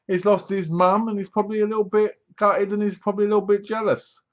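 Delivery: ordinary delivery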